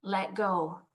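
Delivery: plain, quiet, settled instruction; understated divine command, no push; lightly voiced God. God instructing the man hanging from the branch, lightly voiced and calm. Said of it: "And God says".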